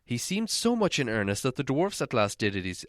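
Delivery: ordinary delivery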